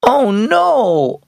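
high disappointed wail; a child's mild wail of letdown; girl missing the shopping trip, wailing. A narrator's reading. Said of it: "Aubrey wailed".

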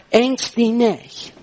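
slow, measured quote of a command; weighty, drawn-out scripture quote; slow, deliberate. Retold or imitated. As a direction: spell it out slowly, word by word. Preacher quotes God's command.

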